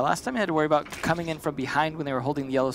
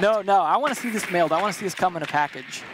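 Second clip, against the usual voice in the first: normal talking voice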